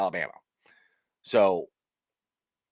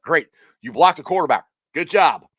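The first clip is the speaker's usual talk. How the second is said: louder mock praise, dripping sarcasm; sarcastic; 3 semitones higher, 7 dB louder than usual. Quarterback mocking teammate's celebration, louder sarcastic praise. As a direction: fake congratulations, laid on thick.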